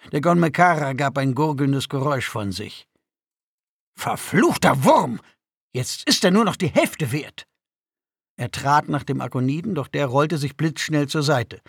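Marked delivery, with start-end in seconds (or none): 3.9-7.5 furious curse. A narrator's reading. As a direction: curse over ruined property. Cursing after the cape tears. (wütendes, erregtes Fluchen)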